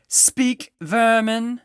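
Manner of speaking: very high, loud order; witch queen's command. A narrator's reading. Witch queen ordering the silent animals, harsh loud command. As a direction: spit out the command at the creatures. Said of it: "she said again".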